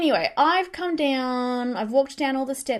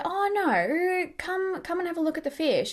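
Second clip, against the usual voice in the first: high imitated pleading of a boyfriend; singsong, pitched-up retold coaxing; 7 semitones higher than usual. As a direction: playfully mimic him urging you along. Retelling boyfriend urging her to look.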